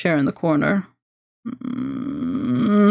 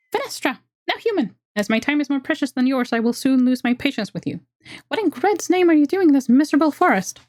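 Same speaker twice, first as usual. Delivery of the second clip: much higher, haughty squeaky elf; high impatient elf demanding answers; 7 semitones higher than usual. Acted. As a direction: squeak with thin patience. Voices the elf woman.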